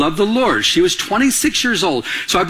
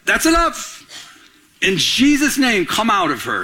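fed-up anger; 2 semitones higher than usual. Acted. Apostle to the possessed girl's demon, fed up and angry. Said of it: "worked up with anger".

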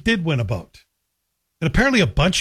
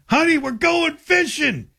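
much higher, slow, hammed-up excited husband; mock excited; 12 semitones higher, slower than usual. Host mocking the boat winner calling to his wife, hammed-up and excited. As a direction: gush like he really won the boat.

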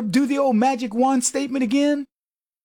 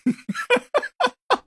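high burst of laughter; 14 semitones higher than usual. Real, not acted. Host laughing at own joke.

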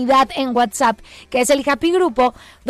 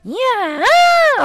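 put-on high, sharp "Ya" like a northern baby's first cry; mock newborn cry, "Ya" said high and hard; blunt baby cry; 9 semitones higher than usual. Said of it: "su primer llanto fue".

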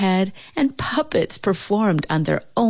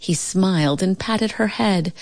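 usual speaking voice

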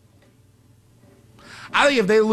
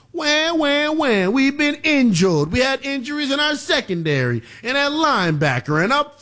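mock whining excuse; 8 semitones higher than usual. Sports host mocking Tampa's injury excuses, whiny. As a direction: whine it like a feeble excuse, pitched up.

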